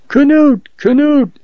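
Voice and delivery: high-pitched narrated cry of terror; terrified scream. A narrator's reading. Woman alone in the storm calls for help, terrified cry. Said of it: "she screamed in terror".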